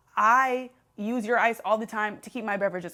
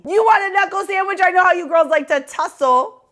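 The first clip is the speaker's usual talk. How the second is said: mock tough guy; 8 semitones higher, 6 dB louder than usual.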